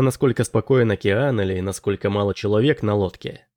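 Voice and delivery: plain reading voice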